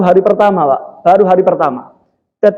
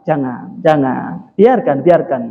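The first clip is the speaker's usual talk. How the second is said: soft, low, calming voice; acted quiet forbearance; gentle restraint; 5 semitones lower, 3 dB quieter, slower than usual. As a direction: soothe an angry helper and hold them back. Prophet calmly restrains the furious angel.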